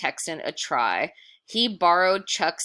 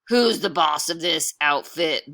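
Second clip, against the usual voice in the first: tough demand; 7 dB louder than usual. Acted. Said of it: "Bill asked".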